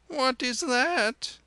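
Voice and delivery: put-on very high, slow, loud child voice; small child. Child seeing the rosary mid-yell, high childish question. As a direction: pipe it up like a curious toddler. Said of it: "said the child".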